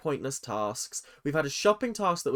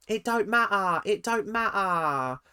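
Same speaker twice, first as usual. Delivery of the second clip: dismissive put-on accent, drawn out; lightly mocking Ella's accent, slower; 5 semitones higher, slower than usual. Vlogger repeats Ella's line in mild mocking accent. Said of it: "that's not her accent".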